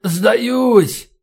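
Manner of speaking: high, strained, agonised cry; wounded man crying out; moaning surrender. A narrator's reading. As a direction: groan out a surrender in pain. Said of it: "простонал полковник".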